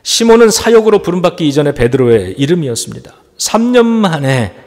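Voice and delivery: normal talking voice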